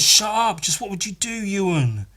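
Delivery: pushy friend. Retold or imitated. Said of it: "he goes".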